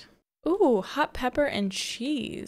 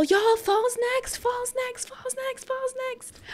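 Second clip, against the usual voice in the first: much higher, squealing repeats; high excited chant; giddy excitement; 3 dB louder than usual. Real, not acted. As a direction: bounce up and down on it, thrilled. Thrilled the season changes.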